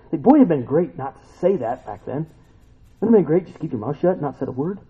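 ordinary delivery

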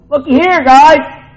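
high, loud exclaiming call; excited looky here. Shown as a demonstration. Preacher acting out Behold for the congregation, high excited exclaiming. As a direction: shout it like a thrilled discovery. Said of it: "see" with an exclamation point".

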